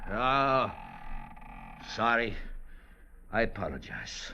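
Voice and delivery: sheepish apology. Acted. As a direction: shrink back and apologize.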